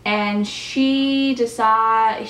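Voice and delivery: usual speaking voice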